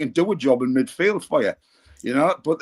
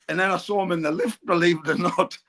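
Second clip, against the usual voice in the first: ordinary talk, nothing marked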